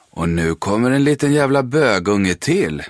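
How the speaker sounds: loud, high mocking taunt; bully jeering. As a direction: jeer at him like a bully. Bully sneers at approaching boy.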